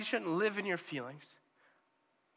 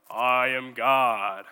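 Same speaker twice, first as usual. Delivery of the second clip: low, slow, booming divine proclamation; God announcing himself in a huge, slow voice; 6 semitones lower, 8 dB louder, much slower than usual. Acted. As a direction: boom it out like a voice from heaven. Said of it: "not a loud, booming".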